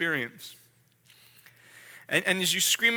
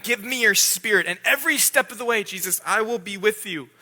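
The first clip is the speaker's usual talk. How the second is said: usual speaking voice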